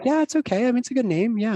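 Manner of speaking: put-on a tiny girl approving; high little girl voice; child's voice. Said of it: "she was just like".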